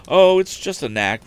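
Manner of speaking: put-on modest young man. A narrator's reading. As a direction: wave the compliment away. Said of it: "replied Tom modestly".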